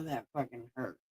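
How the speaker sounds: ordinary talk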